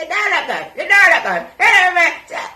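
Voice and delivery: high, loud mocking parrot; mocking mimicry. Mimicking the other speaker's line.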